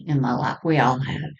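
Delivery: ordinary talk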